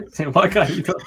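real laughter through the words, high and loud; laughing. Real, not acted. Host tells friends something just fell, laughing hard. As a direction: blurt it out while cracking up.

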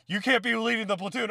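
high, loud shout after a runner; mock calling out. Imitating the platoon calling after a runner, mock protesting shout.

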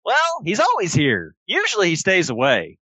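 high, sweet toy-animal voice; childlike plush friend. Acted. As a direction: speak like an eager stuffed elephant bear. GM voices Billy Bucks.

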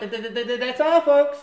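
high stuttering cartoon sign-off; cartoon pig stutter.

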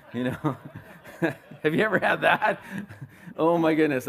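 asking through laughter; laughing. Real, not acted. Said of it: "laughing through the question".

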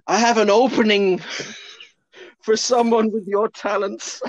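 high quote breaking into laughter; can't hold it together while quoting; giggling as the old guy; laughing through a quote. Retold or imitated. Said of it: "the next line is".

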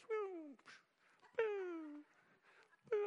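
squeaky falsetto boo as the Cheerio flies; a goofy high-pitched boo sound; high silly boo. Retold or imitated. Playing the flying Cheerio in a squeaky boo.

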